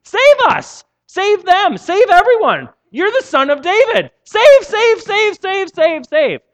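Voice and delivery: high, loud chanting shouts of save; children's shouted cheer. Retold or imitated. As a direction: cheer it like excited kids in the temple. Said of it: "they're like".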